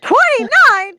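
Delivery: loud, high disbelief; shocked. Real, not acted. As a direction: blurt it out in shock. Shocked at the count.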